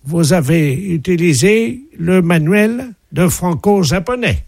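pompous official greeting the mayor; mock ambassador voice. Prank caller as ambassador.

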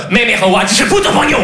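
rising mock-Chinese gibberish outburst; angry mock Chinese. Actor bluffs his scene opposite Chinese partner, angry fake Chinese. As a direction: improvise irritated fake Chinese. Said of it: "Le chinois énervé".

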